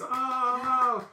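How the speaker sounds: high, drawn-out group groan; mock dismay. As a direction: let out a long high "oh no". Family reacts to repeated gift.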